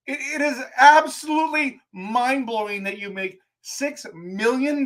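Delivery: high-pitched, incredulous rant at a player; exasperated rant. Real, not acted. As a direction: vent at him like you can't believe it. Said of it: "I'm just ranting".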